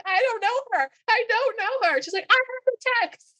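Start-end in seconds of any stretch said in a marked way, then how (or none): 0.0-2.0 high, loud, wailed denial; mock crying. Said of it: "He's, like, crying". 2.3-3.3 very high, loud mock insistence; dramatic wedding objector. Acted objector insisting with proof.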